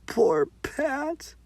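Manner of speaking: ordinary delivery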